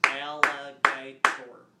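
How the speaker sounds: AL-LI-GA-TOR, very slow and loud, four beats; clapped-out syllables. Shown as a demonstration. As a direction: punch out "Alligator" as four separate syllables. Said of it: "look at the word and clap it out".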